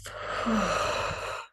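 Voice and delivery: long sighing breath out through the mouth; audible exhale. Shown as a demonstration. Yoga teacher demonstrating the out-breath, long exhale. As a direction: let the breath go in a slow, airy sigh. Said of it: "breathe out of the mouth".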